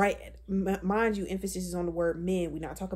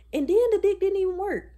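much higher and louder, exasperated; outraged outburst; 11 semitones higher, 7 dB louder, faster than usual. Real, not acted. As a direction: blurt it out in disbelief. Outraged punchline.

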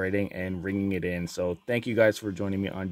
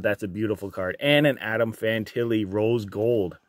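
ordinary delivery

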